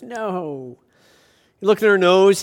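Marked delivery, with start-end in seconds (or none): 0.0-0.8 put-on a soft, small little-girl "No."; lightly voiced child, softer. Joke, little girl answers.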